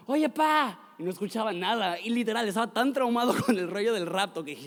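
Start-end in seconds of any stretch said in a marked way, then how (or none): 0.1-0.8 high, loud call for dad; retold child's yell. Preacher recalling himself as a boy calling his dad, yelling. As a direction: call out like a kid hollering for his father. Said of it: "que le gritaba".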